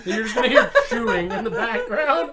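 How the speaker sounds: high, loud laughing words. Real, not acted. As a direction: talk through a laugh. Laughing apology about chewing.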